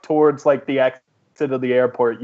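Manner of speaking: usual speaking voice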